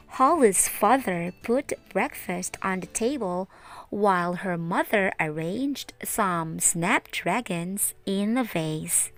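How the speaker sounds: usual speaking voice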